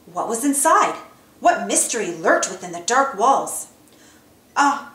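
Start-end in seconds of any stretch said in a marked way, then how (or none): 4.6-4.9 nervous. Acted. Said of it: "Mickey asked nervously".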